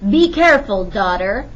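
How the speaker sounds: sharp fatherly warning; careful warning. A narrator's reading. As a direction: warn her, loud and sharp. Bowie warns the girl.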